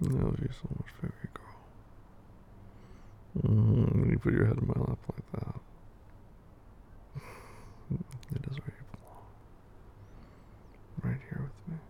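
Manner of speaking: whispered tender sweet nothings. Acted. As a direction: breathe loving words close to the ear. Tender whispered love talk.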